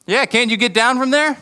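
high-pitched taunting question. Acted. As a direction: heckle with a rising, needling lilt. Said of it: "taunting him, potentially saying".

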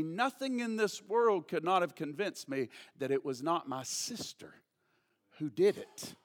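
low, quiet retold insistence; hushed conviction. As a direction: drop down low and soft, pausing before the end. Brother's firm belief, retold low.

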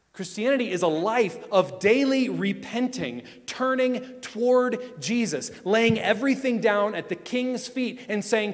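loud, urgent pulpit plea; fervent sermon climax; preaching at full force. Acted. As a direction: call them to lay it all down. Impassioned call to surrender.

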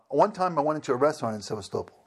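ordinary talk

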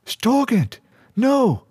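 very high-pitched cry of protest; alarmed shout. A narrator's reading. Girl tries to halt the attack, high narrated shout. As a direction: cry out high to stop him. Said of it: "Bree shouted".

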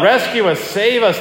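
lightly voiced terrified disciples begging for rescue; mock shriek. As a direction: read the disciples' cry with a hint of alarm. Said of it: "they shrieked".

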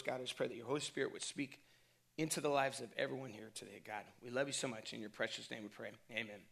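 soft, earnest spoken prayer. Acted. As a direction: pastor praying over the congregation. Opening prayer before the sermon.

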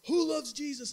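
high loud rallying call; translator calling out. Retold or imitated. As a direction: rouse a room of kids. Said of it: "he says".

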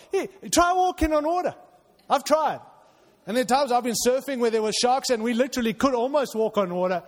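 loud, high-pitched sermon delivery; full-force preaching. Acted. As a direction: preach it loud and urgent to the congregation.

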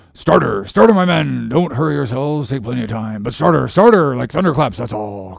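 boat officer urging on his rowers, loud bursts easing off; crying out orders. A narrator's reading. Stubb to his crew while spluttering pipe smoke, crying them on. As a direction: cry "starter" loud, then ease back. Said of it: "cried Stubbs, spluttering out the smoke".

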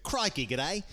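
mock Aussie catchphrase; wife's Australian accent. Comic showing wife's stage character, in an exaggerated Australian accent. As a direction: toss off a caricatured Australian sign-off. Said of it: "my wife's character on stage".

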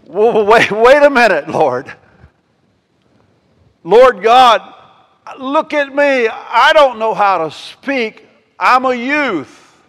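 high, loud, pleading panic; a scared kid begging off the job; preacher acting out Jeremiah's frantic protest to God; panicked young Jeremiah. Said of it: "It was more like".

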